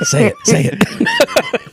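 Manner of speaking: very high squeaky urging; squeaky egging on. Acted. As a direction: squeal it to egg them on.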